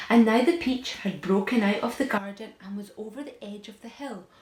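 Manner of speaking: dramatic narration. A narrator's reading. Dramatic peach rolling narration.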